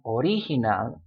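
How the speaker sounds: "original" in Spanish as o-RI-hi-nal; stress on second syllable. Shown as a demonstration. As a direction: lean on the RI in "original". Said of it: "which syllable to stress".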